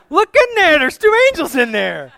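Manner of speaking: loud, high-pitched exclamation; incredulous shout. Acted. Mary points the gardener to angels in the grave, shouting incredulously. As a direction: yell it in disbelief, starting very high.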